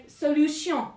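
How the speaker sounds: "solution" said the French way; French accent. Shown as a demonstration. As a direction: model the French pronunciation clearly. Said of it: "you just say it in a French accent".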